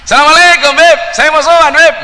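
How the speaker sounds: high, loud Madurese greeting; a Madurese visitor greeting the Habib; Madurese accent. Retold or imitated. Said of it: "ada orang Madura sowan".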